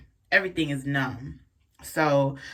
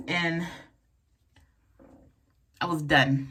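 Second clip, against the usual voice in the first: usual speaking voice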